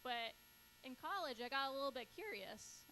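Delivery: ordinary delivery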